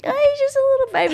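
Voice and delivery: high, quick, cooing mum voice; imitating mum. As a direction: mimic a mum excusing her little one. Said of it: "and then Mum's like".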